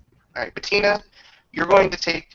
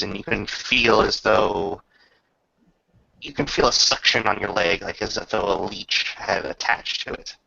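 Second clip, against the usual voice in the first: game master's scene narration; 5 semitones lower than usual. Acted. GM describing a root creature to Bettina, ominous narration. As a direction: paint the creeping horror for the player.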